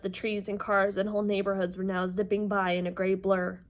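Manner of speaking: plain reading voice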